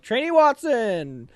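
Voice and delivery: high, slow, loud call of the name; drawn-out summons from the stand; drill sergeant call. Retold or imitated. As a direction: bellow the trainee's name across the bay. Drill sergeant calling trainee out.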